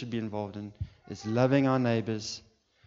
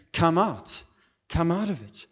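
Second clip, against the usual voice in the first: high, urgent, loud summons; raised voice, short punched phrases; calling out; 7 semitones higher, 7 dB louder than usual. Acted. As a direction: beckon them out with full preaching force. Preacher calls out as Jesus.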